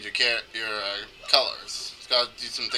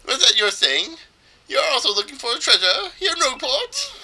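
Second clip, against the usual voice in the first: high, incredulous theatrical question; fey trader; 12 semitones higher, 6 dB louder than usual. Acted. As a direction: ask in a fey, swooping high voice. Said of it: "make this guy sound as fey as possible".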